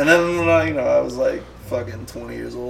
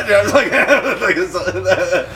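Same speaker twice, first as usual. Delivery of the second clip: laughing; 7 semitones higher, 7 dB louder than usual. Real, not acted. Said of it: "getting, like, nervous and shit".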